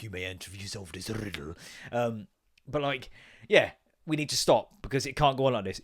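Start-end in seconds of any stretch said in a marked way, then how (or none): 0.0-1.5 lower, softer wizard intoning; mock wizard voice. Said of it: "a wizard there being like".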